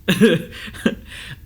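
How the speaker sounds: a short breathy laugh at the memory; rueful laugh. Real, not acted. Vlogger laughing about a ten-hour session, rueful laugh. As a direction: laugh it off, half embarrassed. Laughing at own breakdown story.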